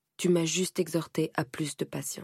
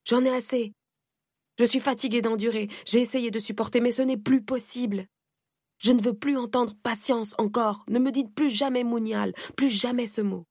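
sobbing outburst; 6 semitones higher than usual. Acted. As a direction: let it burst out through tears. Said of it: "éclatant en sanglots".

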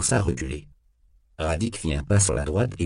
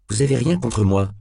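defensive protest, raised and louder; on the defensive, voice lifted; 5 semitones higher, 5 dB louder than usual. A narrator's reading. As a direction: push back like a cornered suspect. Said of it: "déclara Temple sur la défensive".

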